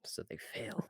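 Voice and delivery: gleeful whisper. Real, not acted. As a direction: whisper the victory under breath. Quiet glee after failed save.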